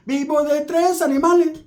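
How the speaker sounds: high put-on Mexican voice; Mexican accent. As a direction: belt it out like a proud paisa. Spanish exclamation in put-on voice.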